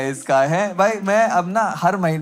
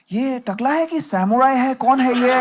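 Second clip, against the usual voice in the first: higher, slow puzzled question; hammed-up wonder; mock bafflement; 5 semitones higher, slower than usual. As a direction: imitate baffled onlookers, pitched up. Baffled onlookers acted at man bun.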